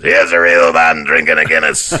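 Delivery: low, loud Irish brogue, arm round a stranger; hearty tipsy Irish praise; drunk Irishman. Retold or imitated. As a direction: boom it out like a drunk new best friend. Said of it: "he goes".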